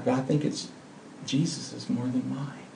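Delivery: whispered aside. Real, not acted. Pastor confiding his heart's desire, in a whisper. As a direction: breathe it softly to the congregation.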